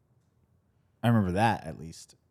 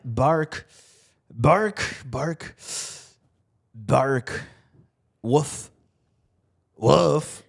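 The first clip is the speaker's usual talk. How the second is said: yappy chihuahua voice; 4 semitones higher, much slower than usual. Retold or imitated. Imitating dog barks.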